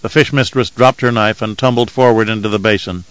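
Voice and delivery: ordinary delivery, nothing marked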